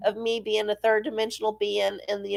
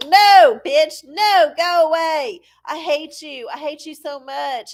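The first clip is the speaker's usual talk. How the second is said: higher, loud then fading "No, bitch"; hammed-up rebuff that trails off; mock angry rejection; 5 semitones higher, 6 dB louder than usual. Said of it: "they're like".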